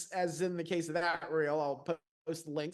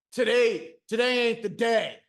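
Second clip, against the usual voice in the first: imitated coworker banter; 3 semitones higher, 5 dB louder than usual. Man teasing a new coworker with overheard phrases, mock emphatic. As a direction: throw out a borrowed phrase emphatically.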